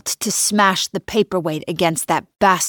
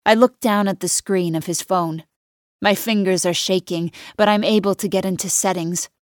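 ordinary delivery, nothing marked

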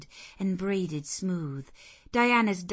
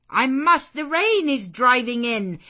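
much higher and louder, shut her out; firm shout; 7 semitones higher, 12 dB louder than usual. Acted. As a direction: call it over the storm. Servant shouting back.

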